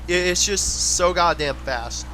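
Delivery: normal talking voice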